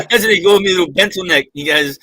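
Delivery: ordinary talk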